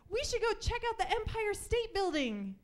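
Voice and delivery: put-on girl in the car chiming in; eager kid. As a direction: chirp it like an excited kid. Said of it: "Chandra said".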